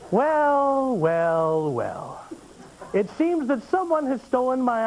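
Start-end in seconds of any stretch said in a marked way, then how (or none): 0.1-1.0 indignant well. Acted. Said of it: "mock indignant well". 1.0-1.7 falling well. Acted. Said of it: "mock indignant well". 1.7-2.2 low huffy well. Acted. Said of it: "mock indignant well".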